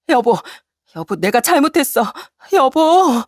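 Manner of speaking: high, rising desperate plea; desperate begging wife. Acted. Wife begging as husband walks out, desperate. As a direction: cry out after him, begging. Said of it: "애원하는 아내를".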